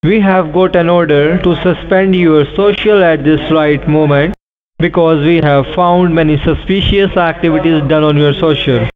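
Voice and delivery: ordinary delivery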